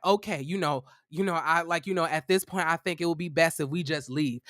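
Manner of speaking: a hint of a cowed roommate giving in; meek roommate. Roommate backs down meekly.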